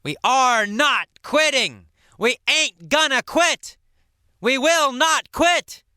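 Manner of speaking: high, defiant call out; rally the team, refusing to quit; determined yell. Acted. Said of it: "Todd yelled".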